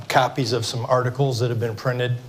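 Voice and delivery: usual speaking voice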